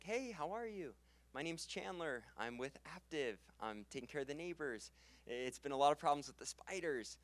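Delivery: every line ends climbing up; up pitch. Shown as a demonstration. Trainer showing new reps' nervous rising pitch at the door. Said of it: "they'll up pitch super hard".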